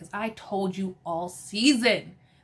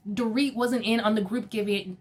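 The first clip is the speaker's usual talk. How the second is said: usual speaking voice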